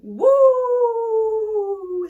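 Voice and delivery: long, very high, loud triumphant whoop. Real, not acted. Cheering herself on, long loud whoop. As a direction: hold a big victory cry.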